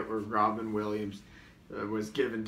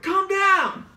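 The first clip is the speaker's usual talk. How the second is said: much higher and louder shriek; yelling; 13 dB louder than usual. Retold or imitated. Trying to calm a wild impersonator, shrieking yell. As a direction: shriek at him to stop. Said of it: "I'm like".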